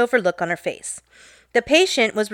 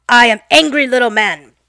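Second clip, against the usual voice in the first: louder, lightly voiced grumpy man; clearly stated name; 6 dB louder than usual. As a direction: state it loudly like the grumpy customer. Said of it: "clearly says his name".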